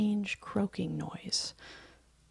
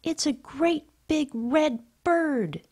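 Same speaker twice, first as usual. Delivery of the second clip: excited call, much higher, louder and slow; 9 semitones higher, 5 dB louder, slower than usual. Acted. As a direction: shout up the news with wonder. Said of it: "he called".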